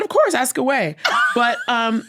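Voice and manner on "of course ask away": chatbot reply. Retold or imitated. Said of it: "And I said".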